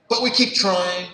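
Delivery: the first, loudest take of the repeat; loud insistence. Shown as a demonstration. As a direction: hit it hard, the peak of a fading chain. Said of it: "and we keep trying".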